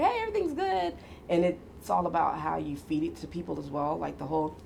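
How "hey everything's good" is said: much higher, forced bright front; putting on a cheerful face for others; fake cheerful. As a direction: paste on a cheerful mask. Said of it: "I look fine on the outside".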